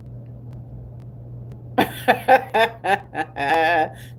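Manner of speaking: long hearty laugh; real amused laughter. Real, not acted. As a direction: laugh it out at length. Amused by country wording.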